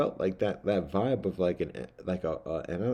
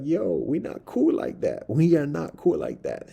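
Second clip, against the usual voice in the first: slow, raised, firm warning; loud voice; 10 semitones higher, 5 dB louder than usual. Retold or imitated. Man telling the mouse off in a loud voice. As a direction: tell it off loudly and slowly. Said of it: "I said it in a loud voice".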